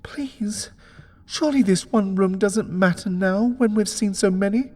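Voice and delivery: high, thin pleading; frightened begging. Acted. Wife begs her husband to leave, high and scared. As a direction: beg him to go, scared.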